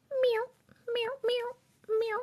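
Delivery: high soft kitten meows; mewing like a little cat; playful meow. Pretend. Streamer fiddling with gear, meowing softly.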